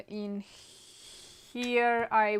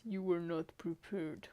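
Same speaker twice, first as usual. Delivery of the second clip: lightly voiced robot announcer; a hint of a game's robot voice; 4 semitones lower, 9 dB quieter than usual. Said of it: "it tells me with a robot voice".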